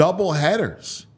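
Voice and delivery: normal talking voice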